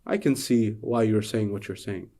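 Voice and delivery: soft, low, smiling reassurance; smiling gently. Acted. Sighted man smiling kindly at the arguing blind men, softly. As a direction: say it softly with a smile. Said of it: "the man begins to smile".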